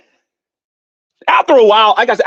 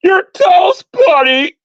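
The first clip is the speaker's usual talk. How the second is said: mocking her catchphrase; 12 semitones higher, slower than usual. Imitating a rival's catchphrase, high shouted mock. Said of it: "That's what she likes to do".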